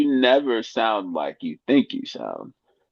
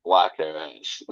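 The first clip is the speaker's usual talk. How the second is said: normal talking voice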